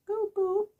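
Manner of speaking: very high, loud falsetto; loud, squeaky put-on voice; high-pitched mock cheer. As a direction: squeal it out like a mock battle cry. Voices Firefly in imagined final scene.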